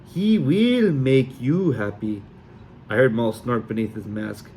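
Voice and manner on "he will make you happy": put-on slow, hammy Sully accent; sing-song fake Sully accent; bad Sully accent. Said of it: "my accent was even worse".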